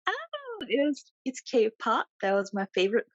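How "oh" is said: usual speaking voice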